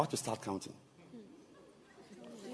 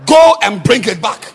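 loud order; 9 semitones higher, 22 dB louder than usual. Retold or imitated. Bishop ordering, laughing.

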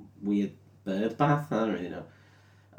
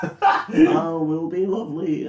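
hammed-up evil queen rant, slightly higher; evil witch; 6 semitones higher than usual. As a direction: ham up a cackling sorceress losing it. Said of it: "and starts going".

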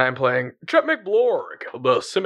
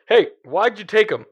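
higher, louder demand; interrogating; 8 semitones higher, 4 dB louder than usual. Acted. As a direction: grill the prisoner. Interrogating prisoner in character.

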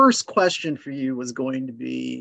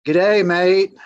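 louder, hammed-up Australian greeting; Australian accent; 3 semitones higher, 6 dB louder than usual. Shown as a demonstration. Podcast host introducing Crocodile Dundee, attempted Australian accent. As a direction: put on your best Australian accent. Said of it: "there's my best Australian accent".

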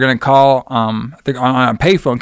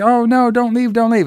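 man doing a woman's voice; 8 semitones higher than usual. Retold or imitated. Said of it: "He's like".

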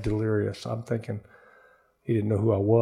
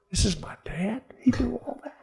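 high, softer, whispery disbelief; hushed amazement; 9 semitones higher, 8 dB quieter than usual. Retold or imitated. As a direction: marvel in a high half-whisper. Marvelling at dad's words.

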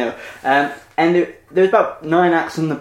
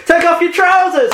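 heckler's shout; 13 semitones higher, 4 dB louder than usual. Retold or imitated.

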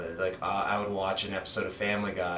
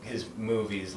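usual speaking voice

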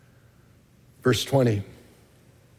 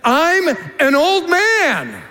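high, slow and emphatic, every word weighed; emphatic protest; 10 semitones higher, much slower than usual. Retold or imitated. Zechariah protesting his age to Gabriel, high and emphatic. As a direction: lean hard on old man, slowly. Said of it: "it's emphatic in the Greek".

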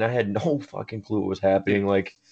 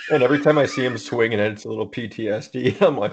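ordinary talk, nothing marked

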